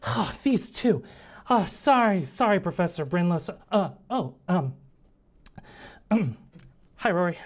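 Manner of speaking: put-on awkward, tongue-tied young intern; flustered student. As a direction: stammer a sorry, then a small shy greeting. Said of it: "She's like".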